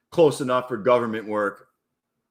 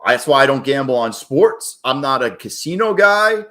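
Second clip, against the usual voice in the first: usual speaking voice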